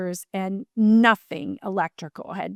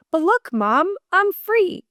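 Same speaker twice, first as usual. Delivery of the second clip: son's voice; 9 semitones higher than usual. Retold or imitated. Son's voice retold, speaking freely.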